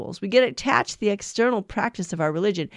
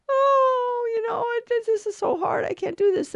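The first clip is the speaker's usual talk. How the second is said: high, tearful mock whine; mock whining; 14 semitones higher than usual. Priest mimics a believer whining to God, high mock cry. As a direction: whimper it like a complaining believer who gives up. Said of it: "complain and whine and say".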